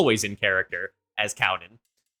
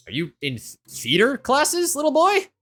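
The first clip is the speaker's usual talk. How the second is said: uncle voice; 12 semitones higher than usual. Acted. Uncle supervising kids asks the boy, mildly puzzled. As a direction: ask it like a bemused relative. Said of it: "kinda looks at you and goes".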